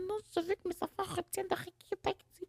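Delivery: high, put-on voice for a silly imagined thought; mock inner voice. Mocking the parent she refuses to be, in a high put-on voice. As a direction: squeak it out as the parent she isn't. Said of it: "pas activement en train de me dire".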